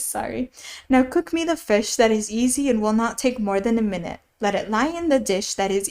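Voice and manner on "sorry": ordinary delivery, nothing marked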